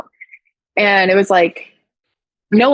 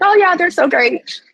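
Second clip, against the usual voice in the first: chirpy self-quote; 11 semitones higher than usual. Retold or imitated. Retelling own chirpy reply.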